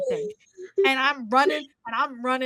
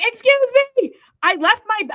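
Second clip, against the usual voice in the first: frantic plea; 12 semitones higher, 7 dB louder than usual. Retold or imitated. Urgently approaching the ticket guy.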